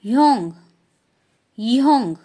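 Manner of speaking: "योंग" short and loud; teacher modelling Korean "योंग"; Korean word. Shown as a demonstration. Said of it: "older brother".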